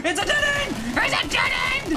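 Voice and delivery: high, panicked yelp; panicked shout. Real, not acted. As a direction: shriek it in alarm. Sudden panic in the game.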